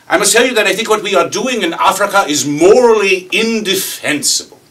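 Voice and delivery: high, loud British outrage; mimicked British accent at full volume; British kid. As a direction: declaim it as an indignant young Brit. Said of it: "this Brit kid that works for us".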